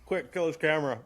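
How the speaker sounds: urgent words through a mouthful; mouth full of peanut butter. Real, not acted. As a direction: mouth full, quick plea. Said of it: "talking with my mouth full".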